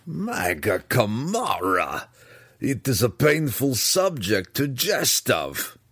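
lightly voiced Belwar, slow and drawn out; slow mock-pained objection; amused protest. A narrator's reading. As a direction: protest slowly, with a touch of reluctant amusement. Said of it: "he chuckled in spite of himself".

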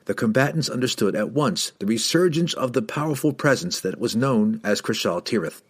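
ordinary delivery

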